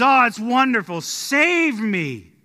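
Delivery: mild mock relief, then a plea; relieved plea. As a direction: gasp it out, grateful and pleading. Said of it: "And he says".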